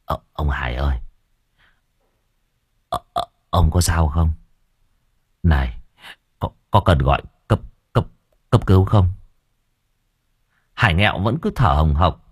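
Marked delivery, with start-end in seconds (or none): 0.1-9.1 low, hesitant stammer; trembling. Acted. Master asking the injured man, low trembling. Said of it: "cậu Cẩn run run".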